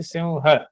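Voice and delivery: सिंह said as "simha"; correct pronunciation. Shown as a demonstration. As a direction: sound सिंह as सिम्-ह, with the ह clear. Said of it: "उच्चारण पे आपको बात बोली थी". (सिंह को सिम्ह बोलें)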